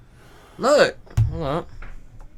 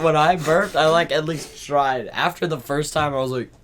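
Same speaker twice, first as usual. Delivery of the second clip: ordinary talk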